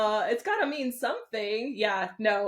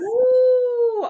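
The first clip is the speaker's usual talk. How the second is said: loud, very high drawn-out "ooh"; surprised "ooh"; 9 dB louder than usual. Real, not acted. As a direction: whoop a long, high "ooh" at being wrong. Reacts to getting it wrong.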